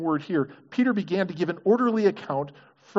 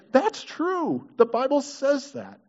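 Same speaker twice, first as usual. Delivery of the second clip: high-pitched, emphatic realization; convinced discovery; 8 semitones higher, slower than usual. Acted. Listener finding it in the text, emphatic conviction. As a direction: exclaim it as your own discovery.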